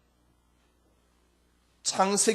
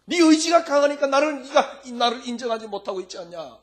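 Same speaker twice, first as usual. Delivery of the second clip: insistent Jesus; 6 semitones higher, 5 dB louder than usual. Acted. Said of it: "이 말이거든".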